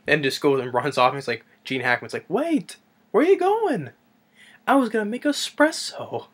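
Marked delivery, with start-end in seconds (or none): 2.3-6.3 calling after someone. Retold or imitated. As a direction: imitate a man calling after a fleeing guest. Imitating Gene Hackman's character calling after.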